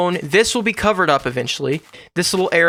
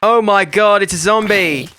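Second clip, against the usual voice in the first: startled; 4 semitones higher, 4 dB louder than usual. Real, not acted. Zombie appears suddenly.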